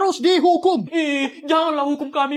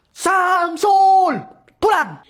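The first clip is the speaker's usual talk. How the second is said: loud, higher bellow of the name; angry shout; 5 semitones higher, 4 dB louder, much slower than usual. Acted. Parent shouting at the son found squatting. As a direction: yell the name at him.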